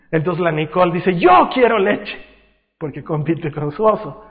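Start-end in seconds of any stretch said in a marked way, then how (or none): 1.2-2.2 put-on high, loud, drawn-out child demand; insistent toddler. Toddler competing with her bear for milk, insistent shout. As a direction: outdo the teddy with your demand.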